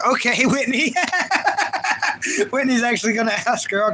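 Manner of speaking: high, breaking into a long laugh. Real, not acted. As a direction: laugh hard, then talk on through it. Amused by chat member's reply.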